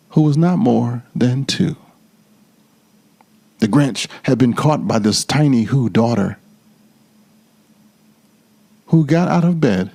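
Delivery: ordinary delivery, nothing marked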